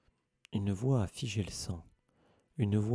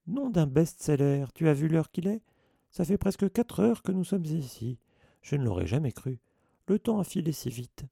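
higher and louder, bubbly surprise; chirpy chatter; 7 semitones higher, 4 dB louder than usual. Acted. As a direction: gush about the time. Lockhart chattering cheerfully.

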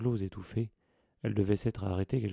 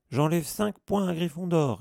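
loud, high clipped penalty; curt, cutting; 9 semitones higher, 7 dB louder than usual. Acted. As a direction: snap out the sentence. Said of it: "répliqua Percy d'un ton cassant".

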